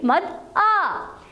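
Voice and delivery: long, weighty आ as a teacher's demo; stressed long aa. Shown as a demonstration. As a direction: lean on आ and stretch it out long. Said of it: "अलिफ मद आ को हमने थोड़ा सा ज़ोर देकर बोला था".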